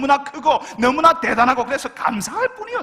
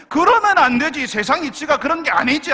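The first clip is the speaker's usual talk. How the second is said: higher, louder indignant protest; mock outrage at unfair grace; loud, high protest as an indignant churchgoer; worked-up complaint; 5 semitones higher, 7 dB louder than usual. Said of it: "불평 흥분을 하는 거죠".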